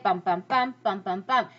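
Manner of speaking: "bump, bump" chanted in alternating stressed and unstressed beats; rhythmic meter beat. Shown as a demonstration. Teacher sounding out meter for students, rhythmic "bump". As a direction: tap out the meter with each "bump". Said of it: "all of these stressed, unstressed".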